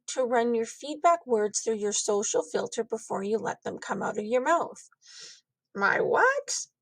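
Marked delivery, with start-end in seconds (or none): 5.7-6.7 put-on high, startled kid question; a kid caught off guard; child confused by a new word, high; puzzled child.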